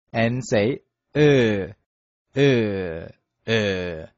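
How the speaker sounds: ы said with lips stretched wide sideways. Shown as a demonstration. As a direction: stretch the mouth sideways and say ы slowly. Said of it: "stretch your mouth sideways like this".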